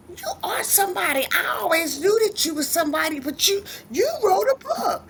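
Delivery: high, quick gushing praise; imitated proud husband. Husband praising author wife, high proud insistence. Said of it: "He was like".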